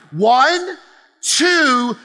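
rally the room on each number; booming countdown to a crowd shout; loud countdown. Real, not acted.

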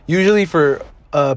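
normal talking voice

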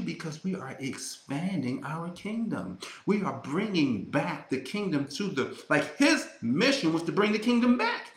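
usual speaking voice

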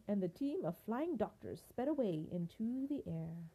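usual speaking voice

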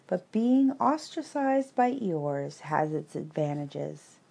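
ordinary delivery, nothing marked

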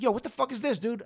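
retold panic, pitched high; freaking out. Young man cursing at the tester over the wires, freaking out. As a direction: act out freaking out at a stranger. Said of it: "I start freaking out".

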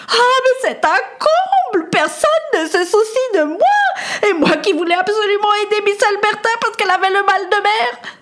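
high, tearful noblewoman, fast at the end; a spoilt lady wailing that nobody cares; indignant weepy lady. A narrator's reading. Lady complains to the boy in the storm, high and tearful. Lady Amelia voiced in story.

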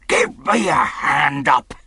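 rough pirate calling out for help; pirate's cry. Acted. Silver demands help getting up, rough pirate cry. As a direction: call out crossly as an old sea dog. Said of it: "he cried".

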